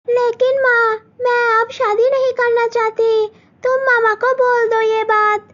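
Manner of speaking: high, pleading daughter voice; pleading young girl. Acted. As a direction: beg her mother not to agree. Malti pleads with mother.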